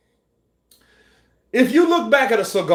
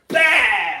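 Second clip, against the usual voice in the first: high, sudden attack cry; loud kung fu impact shout; imitated strike yell; 9 semitones higher, 4 dB louder than usual. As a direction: explode with a mock fight yell. Imitates Van Damme's sudden strike.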